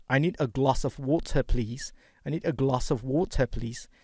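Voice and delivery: I need a glass of water said British, with an open a and no r in water; non-rhotic British water; British accent. Shown as a demonstration. Teacher demos to students, British accent. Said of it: "Kalau British".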